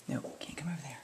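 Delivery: hushed whisper to the pet. Real, not acted. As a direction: whisper a gentle warning. Whispering to bird.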